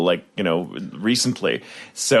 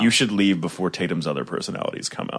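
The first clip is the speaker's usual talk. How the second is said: low, softer menacing deadpan; cold cop; 7 semitones lower, 5 dB quieter than usual. Acted. Cold cop warning of her partner's personalities, low deadpan. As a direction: warn them in a low, cold voice.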